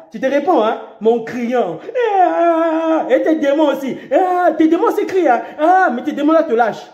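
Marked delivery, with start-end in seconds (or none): 2.1-3.1 high pretend cry of terror; a short staged scream; mock crash scream. Preacher acting a crash victim's scream.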